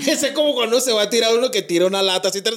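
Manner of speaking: laughing. Real, not acted. Laughing, imitating a can tossed.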